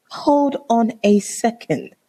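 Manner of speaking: drawn-out, high, deliberate disbelief; slow indignant repeat. Retold or imitated. Woman confronting boyfriend about his ex, slow and indignant. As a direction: spell it out slowly, word by word. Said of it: "This is me".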